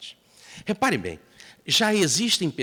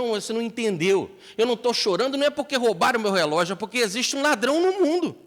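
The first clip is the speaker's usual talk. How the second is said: earnest protest; 8 semitones higher, 4 dB louder, faster than usual. Acted. Gandhi explains his tears.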